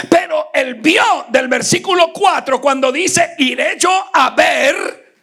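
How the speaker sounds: loud, high preaching; preaching at full force. Acted. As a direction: build the fire of the sermon. (predicación encendida)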